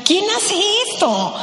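high, amazed, half-laughing question; a retold burst of amazed disbelief, pitched high. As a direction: marvel at how fast the memes appeared. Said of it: "yo decía".

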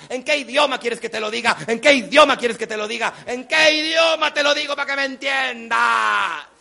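exasperated tirade building to a shout; mother gone crazy. Retold or imitated. Mom demands what language he understands, mounting fury. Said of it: "tu mamá se volvió loca".

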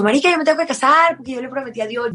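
high, eager burst of news; retold thrilled phone call to her partner; excited call. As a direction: blurt it out, thrilled. Calling Fede after the miracle.

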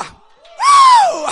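high shouted whoop of joy; joyful whoop. Real, not acted. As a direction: let out a sky-high cry of delight. Whoop at the miracle news.

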